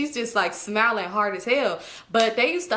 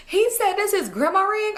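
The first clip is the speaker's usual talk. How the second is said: incredulous auntie; 10 semitones higher than usual. Retold or imitated. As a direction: sell the auntie's gleeful disbelief. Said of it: "she's like".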